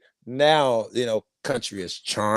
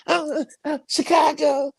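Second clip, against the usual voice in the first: swooning caller. Retold or imitated. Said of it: "I just melt".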